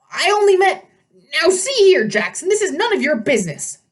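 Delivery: put-on high, loud blustering old satyr; flustered satyr. A narrator's reading. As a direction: bluster to cover fear.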